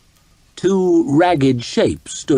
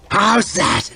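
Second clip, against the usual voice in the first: higher, rough-voiced gloat; gloating taunt; 5 semitones higher than usual. A narrator's reading. Dizzy fighter over a beaten foe, gloating. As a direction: taunt him, reeling with laughter. Bloody Nine gloats while laughing, spinning.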